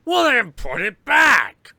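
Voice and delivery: shouted command, high and loud; loud stern order. Retold or imitated. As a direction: bark the order at the kid. Ordering the kid to return it.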